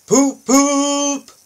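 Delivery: high loud train whistle "poop, poop"; two high piercing whistle toots. Acted. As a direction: toot it like a snooty engine whistle. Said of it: "whistled Spencer".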